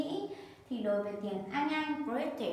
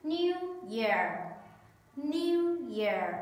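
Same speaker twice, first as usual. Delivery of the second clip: clear, emphatic teacher model; careful classroom model; model for repetition; 2 semitones higher, slower than usual. Shown as a demonstration. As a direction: say it for the class to copy. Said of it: "listen and repeat after me".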